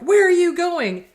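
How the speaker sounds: high, loud demanding outburst; narcissist's yell. Acted. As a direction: yell it as an outraged challenge. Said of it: "a narc who screams and yells".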